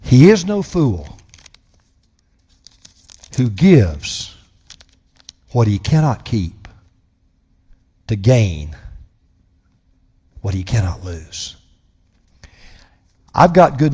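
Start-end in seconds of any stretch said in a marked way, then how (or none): 0.0-11.5 slow and soft, long pauses between phrases; slow repeat. Shown as a demonstration. Preacher repeating a quote so it lingers, slow and soft. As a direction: say it again and let it linger. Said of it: "let that linger in the air".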